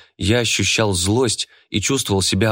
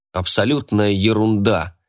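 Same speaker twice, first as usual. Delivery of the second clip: usual speaking voice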